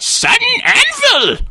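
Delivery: put-on high, slow old man's cry; old man hurling an anvil spell; yelled spell. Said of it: "and then yells out".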